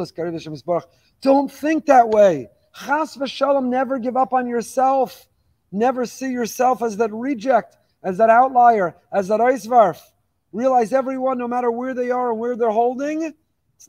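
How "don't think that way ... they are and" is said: urgent plea. Real, not acted. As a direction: plead with them not to despair. Preacher urges listeners not to despair.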